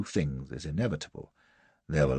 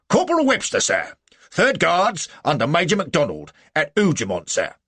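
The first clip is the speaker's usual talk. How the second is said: loud, high military report; sergeant reporting; 10 semitones higher, 8 dB louder than usual. A narrator's reading. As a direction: report smartly to an officer.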